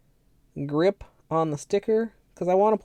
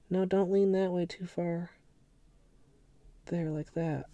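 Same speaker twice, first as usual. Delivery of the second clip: ordinary delivery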